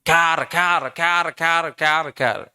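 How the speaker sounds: repeated mock baby wail in evenly spaced bursts; imitated newborn cry. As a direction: mimic a newborn's crying. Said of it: "ఏడుపు ఎలాగుంటది". (పసిబిడ్డ ఏడుపును అనుకరిస్తూ)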